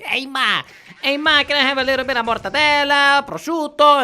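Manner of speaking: high, loud mobster whine; Italian-American guy. Retold or imitated. Guy asks his ma for cold cuts, high and loud. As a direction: call out to your mother like a wiseguy. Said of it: "one of the guys is like".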